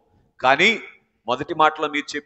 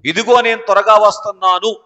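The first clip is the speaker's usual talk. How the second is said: the Lord's cry; 4 semitones higher, 7 dB louder than usual. Retold or imitated. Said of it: "ప్రభు ఏమని మరణాత కేక వేస్తారు".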